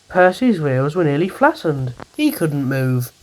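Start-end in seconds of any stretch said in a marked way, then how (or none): none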